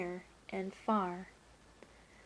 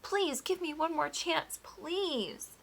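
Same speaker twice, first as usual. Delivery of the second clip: desperate pleading; 8 semitones higher, 5 dB louder than usual. A narrator's reading. Baker begging the duke to stay, pleading. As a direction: beg for mercy.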